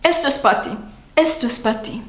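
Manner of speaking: "pa'" for "para" in "Esto es pa' ti"; reduced pa. Shown as a demonstration. Teacher showing the everyday contraction. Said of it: "decimos pa".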